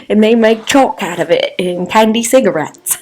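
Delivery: put-on botched Australian accent drifting off; wobbly put-on accent. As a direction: let the fake Australian accent slip into something odd. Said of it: "don't know what that accent became".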